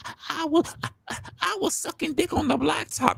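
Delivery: high, quiet granny confession; old lady voice. Retold or imitated. Janice answers doctor.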